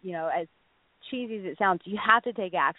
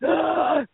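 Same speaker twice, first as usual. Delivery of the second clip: a sharp, loud gasp, higher; starstruck gasp; 7 semitones higher, 4 dB louder than usual. Retold or imitated. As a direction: gasp as if you might faint. Starstruck next to her crush.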